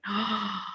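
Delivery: a quiet sharp intake of breath; soft realization gasp. Retold or imitated. Recalling the shower insight, a soft sudden gasp. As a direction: gasp softly as the idea hits. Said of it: "I was like".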